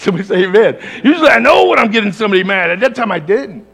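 loud, high excited sermon story; preaching at full force. Acted. Pastor retelling a phone fight, preaching loud. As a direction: fire up the congregation.

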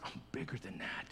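soft unvoiced whisper of God; whispered assurance. God whispering to Joseph that he is bigger. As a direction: breathe it as a secret promise.

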